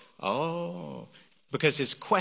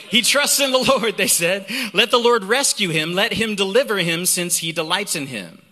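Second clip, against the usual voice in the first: high, loud proclamation easing at the end; forceful psalm reading; 4 semitones higher, 5 dB louder than usual. Acted. Preacher reads the mockers' taunt in the psalm, forceful. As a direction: declaim the verse with force.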